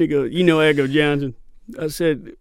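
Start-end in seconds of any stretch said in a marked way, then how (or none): 0.3-1.3 imitated stuntman's accent, louder; Ramon's accent. Actor repeating the stuntman's question for laughs, imitated accent, louder. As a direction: lightly do the cowboy stuntman's accent, voice up. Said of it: "he's got a really great accent".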